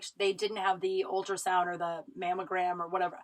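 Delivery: usual speaking voice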